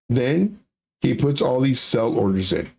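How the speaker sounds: ordinary talk, nothing marked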